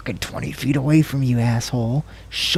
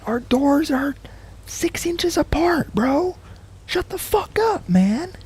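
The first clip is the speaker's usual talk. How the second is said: ranting at the neighbor; slower than usual. Pretend.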